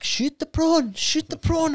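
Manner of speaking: high, loud mimicked yell; mock heckling. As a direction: heckle in a squeaky put-on shout. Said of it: "roasting over there".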